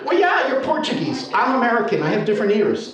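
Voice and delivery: acted exasperated comeback; mock protest. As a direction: plead your case to the teacher. Teller protests to his teacher.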